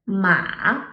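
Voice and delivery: low dipping-then-rising "ma"; U-shaped pitch on "ma"; third tone. Shown as a demonstration. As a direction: start "ma" low, dip, then lift it. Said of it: "starts low, dips, then rises".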